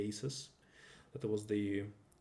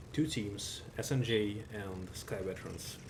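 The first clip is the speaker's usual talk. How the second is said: ordinary talk, nothing marked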